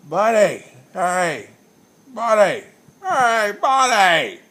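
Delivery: put-on loud, high drunken hollering across the bar; drunken yelling. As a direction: holler at a stranger like a belligerent drunk.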